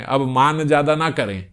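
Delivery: usual speaking voice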